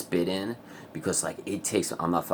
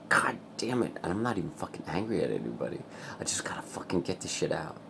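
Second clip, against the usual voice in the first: whisper; 6 dB quieter than usual. Real, not acted. Pent-up energy, trying to calm.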